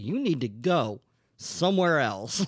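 imitated cop order. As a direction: spell it out like an exasperated cop. Acting cop ordering man away.

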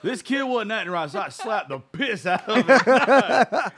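loud, high, breaking up laughing; hammed-up brag dissolving into laughter; joking mock confession. Joking mock version of the story.

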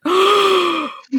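sharp high gasp; family gasping at the eyebrows; shocked gasp. Retold or imitated. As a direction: gasp in horror. Family sees bald eyebrows.

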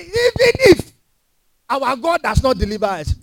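high, ringing vow; powerful defiance. Acted. A preacher voicing the boy refusing temptation, ringing. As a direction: declare it with rising force. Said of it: "how powerful this boy spoke".

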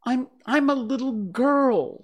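high, halting, unsure answer; loud but uncertain little-girl claim; doubtful. A narrator's reading. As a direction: stammer it doubtfully, pitched high. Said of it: "rather doubtfully".

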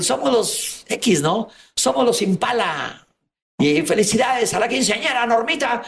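slow, high stage call of a party band MC; band announcer. Retold or imitated. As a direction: announce it like the band emcee at a quinceañera. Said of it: "oí al grupo musical, cuando se despiden". (imitando al animador del grupo musical)

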